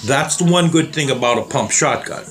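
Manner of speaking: ordinary delivery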